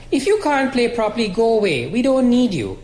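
scolding. A narrator's reading. Loyal sister tells cousin off.

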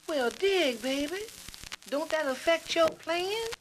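high, slow, puzzled jazz cat; high hipster voice, drawn out; hip jazz musician. Acted. Musician asking about his friend's playing, hip jazz cat. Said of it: "the conversation went like this".

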